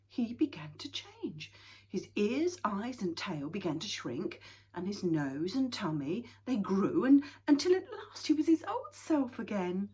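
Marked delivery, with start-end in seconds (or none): none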